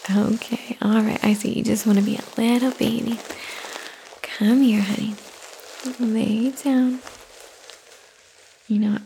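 normal talking voice

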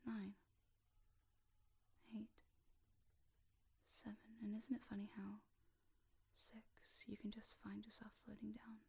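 very soft, spaced-out numbers; hushed, lulling count sinking into trance; hypnotic countdown. Real, not acted.

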